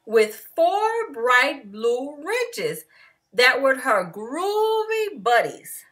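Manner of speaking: plain reading voice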